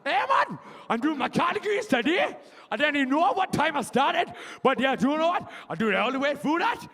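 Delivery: high, loud comic Geordie; Geordie accent. Acted. Audience member Alex explaining revision, hammed-up Geordie accent. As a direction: put on a hammed-up, excitable Geordie. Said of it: "you, you Geordie? I don't, fuck it, you are now".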